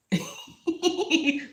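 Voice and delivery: bright high giggle at herself; high laugh. Real, not acted. Laughing after gushing about her cat, high giggle.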